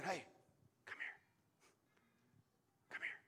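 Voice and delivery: barely audible beckoning whisper; God whispering. Acted. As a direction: whisper it, leaving long gaps. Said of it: "when he begins to whisper".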